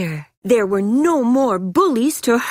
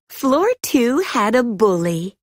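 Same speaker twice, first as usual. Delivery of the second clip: ordinary delivery, nothing marked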